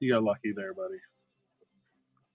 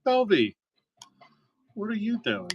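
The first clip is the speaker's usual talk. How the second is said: calling out much higher, half-laughing disbelief; exasperated call-out; 10 semitones higher, 4 dB louder than usual. Real, not acted. Streamer teases viewer Belvy in chat, high exasperated call. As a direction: pitch up, teasing what are you doing.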